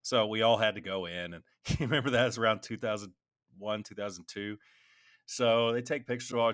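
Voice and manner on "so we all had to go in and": ordinary delivery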